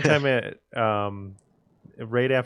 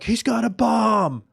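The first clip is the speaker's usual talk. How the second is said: high, drawn-out alarm call; retold yell; 9 semitones higher, 4 dB louder than usual. Guy recalls yelling at the concert crowd, retold yell. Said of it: "I yell out".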